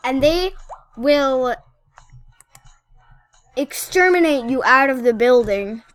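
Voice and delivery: loud, mock-indignant rant at the trainer; mock outrage. Outraged rant at rude trainer NPC.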